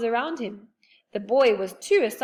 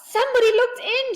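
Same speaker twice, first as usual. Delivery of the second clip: high, loud scared cry; frightened girl at window; 9 dB louder than usual. A narrator's reading. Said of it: "gave a scream".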